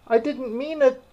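very high, pleading little voice. A narrator's reading. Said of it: "pleaded poor Alice".

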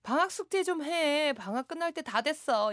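imitated nagging yell. Parent to kids about holiday homework, mock scolding. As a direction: nag as a fed-up parent, mock yelling. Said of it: "이렇게 소리치셨대요".

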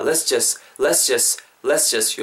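"let's just" blurred into "les-jes"; linked casual pronunciation. Shown as a demonstration. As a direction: drop the t's and join "let's just" as one word. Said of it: "요 정도로 말이죠".

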